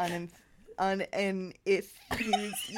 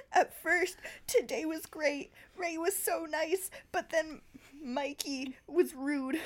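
tearful; 8 semitones higher than usual. Acted. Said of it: "Anna burst into tears".

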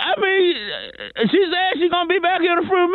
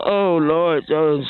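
weary sigh; 11 semitones lower, much slower than usual. Acted. Weary caller sighing over his troubles.

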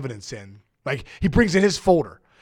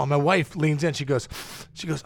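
normal talking voice

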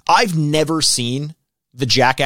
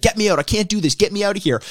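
higher, pleading voice imitating a scared Wee Man; mock panicked plea; 7 semitones higher, faster than usual. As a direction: beg to get out, pitched up. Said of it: "He's like".

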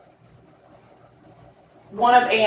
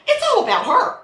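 high-pitched sarcastic jab; mock her self-pity; mocking scorn; 11 semitones higher, 3 dB louder, faster than usual. Mocking the defendant's apology.

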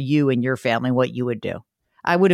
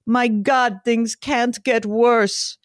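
gloomy Bulgarian pessimist, slow and higher; Bulgarian accent; 6 semitones higher, much slower than usual. Retold or imitated. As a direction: drag out can't get worse in a mock Bulgarian accent. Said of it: "I'll do it with his little accent".

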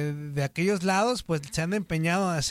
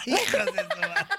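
laughing. Real, not acted. Host laughing at cohosts.

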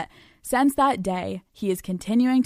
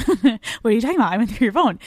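quick, louder, hammed-up confrontation; mock psychotic; 5 dB louder, much faster than usual. Girlfriend confronting boyfriend about his phone, mock psychotic. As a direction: fire it off as a mock unhinged girlfriend. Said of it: "a little too psychotic".

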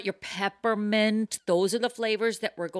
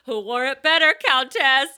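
cheeky taunt; 5 semitones higher, 9 dB louder than usual. Retold or imitated. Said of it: "And I was like".

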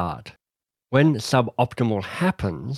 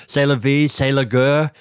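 louder, more hammed-up French accent with a rising end; 3 semitones higher, 4 dB louder than usual. Author repeating the French saying, hammed-up French accent. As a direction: lean into the French accent with a flourish.

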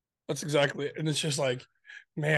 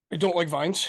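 usual speaking voice

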